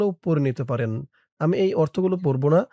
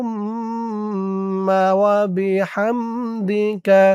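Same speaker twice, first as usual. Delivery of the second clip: drawn-out melodic Arabic recital; slow recitation; 4 semitones higher, much slower than usual. Shown as a demonstration. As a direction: chant it slowly as in prayer. Full recitation after drill.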